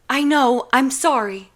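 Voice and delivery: rushed guilty apology; flustered apology. Acted. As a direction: blurt the apology out.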